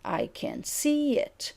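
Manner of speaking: slow, prominence on "see", then down; falling intonation. Shown as a demonstration. Teacher demonstrating a statement, slow and falling. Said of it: "falling intonation".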